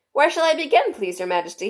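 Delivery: high rabbit voice. A narrator's reading. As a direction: ask like a timid rabbit.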